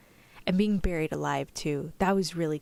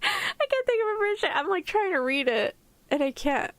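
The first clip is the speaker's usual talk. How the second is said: high, helpless giggly speech; cracking up, voice pitched way up; laughing while talking; 13 semitones higher than usual. Real, not acted. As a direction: talk through a fit of giggles. Cracking up at own failed accent.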